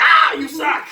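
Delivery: high, loud mock complaint; mock shout. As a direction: yell it like a playful put-down. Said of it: "she's like".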